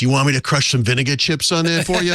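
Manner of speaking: mock-helpful Boston shop guy; helpful clerk. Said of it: "And he goes".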